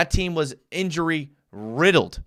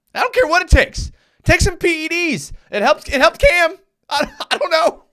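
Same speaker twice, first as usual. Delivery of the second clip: much higher and louder joking voice; mock hype voice; 6 dB louder than usual. Joking that a sluggish lineman needs PEDs, high mock voice. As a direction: crank it up high like a silly gym bro.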